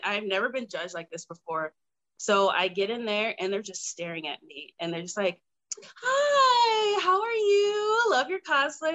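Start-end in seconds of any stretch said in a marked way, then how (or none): 5.8-8.9 high, loud, sing-song gushing hello; gushing judge greeting. Retold or imitated. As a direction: gush over her like an overeager fan. Judges greeting her overly sweetly.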